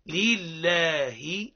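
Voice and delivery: very slow لله with a clear kasra and a long lam; drawn-out model. Shown as a demonstration. Teacher gives the class لله to repeat. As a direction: stretch لله out for them to repeat. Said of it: "هاك تواصل".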